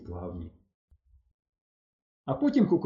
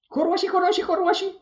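very high-pitched repeated squeal; squealing girl; 4 dB louder than usual. A narrator's reading. Oksana backing the boys, squealing. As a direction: squeak it excitedly in a tiny high voice. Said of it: "заверещала Оксана".

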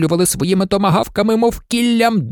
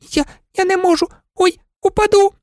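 much higher, panicky squeal; frightened old maid; 14 semitones higher, faster than usual. Acted. Old maid on the branch, high and panicky. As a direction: cry out that she is falling.

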